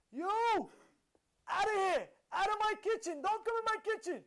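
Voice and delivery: high, loud angry kitchen boss; full-voiced imitation of an irate yell; screaming. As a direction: scream them out of your kitchen. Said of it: "He's screaming at him".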